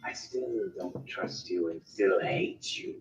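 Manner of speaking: slow, quiet and cold; low, menacing distrust; cold NPC voice. Acted. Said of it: "he looks at you going".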